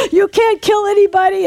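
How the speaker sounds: high, loud acted retort; defiant rebuke. Minister answers the devil's threat, loud defiant rebuke. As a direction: fire back at the devil with authority.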